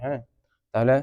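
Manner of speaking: ordinary talk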